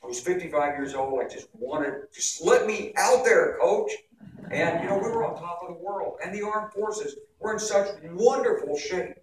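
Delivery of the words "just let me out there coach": higher, louder, slow plea like a keen player; a benchwarmer begging the coach to play; mock eager plea. A retired general itching for business, like a player begging the coach.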